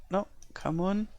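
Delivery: normal talking voice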